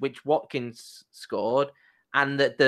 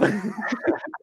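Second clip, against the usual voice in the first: laugh; 8 semitones higher than usual. Real, not acted. Laugh after the impression.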